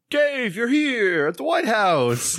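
high, drawn-out greeting; delighted surprise. Acted. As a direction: hail an old friend in a slow, high-pitched call. Obama spots his friend, acted.

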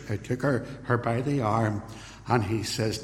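ordinary talk, nothing marked

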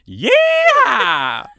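a big excited cowboy whoop. Real, not acted. Co-host whooping at the cowboy hat rule, loud and excited.

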